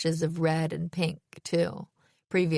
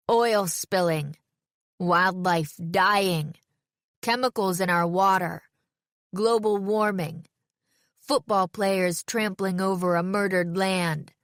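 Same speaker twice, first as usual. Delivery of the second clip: guttural slam poetry. A narrator's reading. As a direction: hammer out each phrase like a protest poem. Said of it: "guttural voice I never would have recognized".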